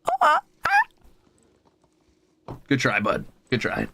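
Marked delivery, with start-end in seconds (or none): 0.1-2.6 squeaky very high mock meow; imitated cat chirp. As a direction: squeak like the cat's failed chirp. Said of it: "He said".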